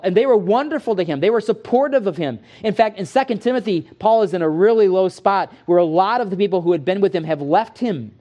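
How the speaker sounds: ordinary delivery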